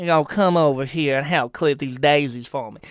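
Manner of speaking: lightly put-on Southern Belle accent; a hint of a Southern Belle inviting you over. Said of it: "Southern Belle accent".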